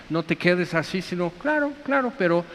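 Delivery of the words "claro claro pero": high, soft conciliatory reply; mock placating. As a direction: pitch it up and soften to placate. Models a placating reply to objectors. (conciliador, agudo y suave)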